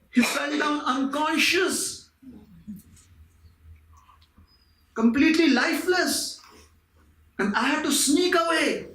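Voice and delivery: high, agitated retelling. Acted. Man tells his parrot the sage collapsed, agitated. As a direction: blurt out the shocking news, high.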